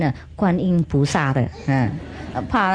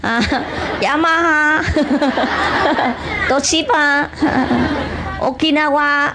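a giddy summons to come eat; excited calling out; 9 semitones higher, slower than usual. Acted. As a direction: wave everyone over, giggling. Monk calls children to feast.

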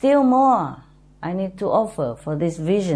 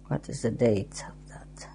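whispered question to herself; whispering; 7 semitones lower, 9 dB quieter than usual. Real, not acted. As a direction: whisper it while searching a diary. Muttering while checking diary.

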